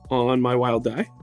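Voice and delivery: ordinary talk, nothing marked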